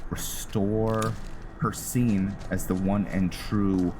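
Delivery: usual speaking voice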